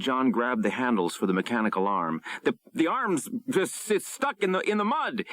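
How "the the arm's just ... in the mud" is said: trapped pilot yelling in panic; panicked yell. A narrator's reading. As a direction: stammer it out in alarm. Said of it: "he yelled".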